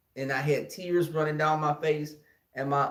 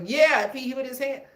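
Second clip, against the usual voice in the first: high, pushy auntie voice; fierce aunt; 9 semitones higher, 5 dB louder than usual. Retold or imitated. Aunt telling her nephew to hit the bully back. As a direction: urge him to fight back. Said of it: "she was like".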